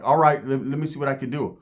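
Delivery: usual speaking voice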